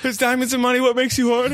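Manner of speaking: high, fast mock innocent question; high-pitched question. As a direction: pipe it up high and quick. Son asks in high fast voice.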